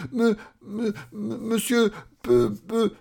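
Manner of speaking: high, frightened stutter; Monsieur Peureux stammering. Acted. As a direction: stumble fearfully over your own name. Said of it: "bégaya Monsieur Peureux". (bégaiement aigu et apeuré)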